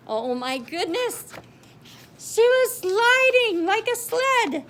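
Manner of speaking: loud, high thrilled storytelling; gasping excitement as she flies; excited narration. Acted. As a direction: ride the thrill up.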